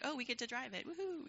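high, playful mock glee, squealing woohoo; mock excited. Imitating young leaders thrilled to take over the ministry, high mock glee. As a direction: play the kid thrilled to drive the ship. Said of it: "and we just are like".

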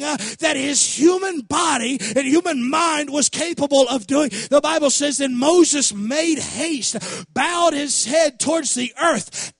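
loud, driving sermon delivery; full-force preaching. Real, not acted. Preacher telling church how Moses bowed in worship, loud full-force preaching. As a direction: preach it hard, leaning on the key words.

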